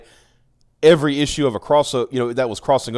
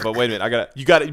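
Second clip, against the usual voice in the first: ordinary talk, nothing marked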